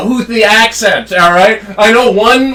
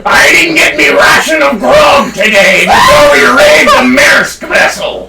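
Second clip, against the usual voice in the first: hammed-up, growling sea dog; pirate voice; 5 semitones higher than usual. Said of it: "It's a pirate voice".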